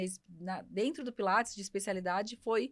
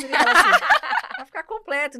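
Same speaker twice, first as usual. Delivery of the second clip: laughing hard through the words; can't stop laughing while telling it; 8 semitones higher, 14 dB louder than usual. Real, not acted. Laughing as she tells friends her husband's joke.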